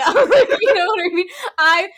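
laughing helplessly through the words; real laughter breaking up the line; crying laughing. Real, not acted. Cohost cracking up over his retold story, laughing. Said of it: "I'm crying".

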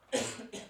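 real coughing fit; chesty cough. Real, not acted. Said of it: "sorry voor die hoesbuien".

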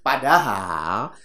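ordinary delivery

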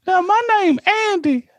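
very high, squeaky reply; a teen boy answering; high boyish voice. Pretend. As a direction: play the young cashier's unbroken voice. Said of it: "I don't know why I'm talking like that".

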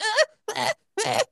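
high fussy baby cries imitated; high mock baby wail; baby freaking out. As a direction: mimic a toddler panicking at the doctor. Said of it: "she's just like".

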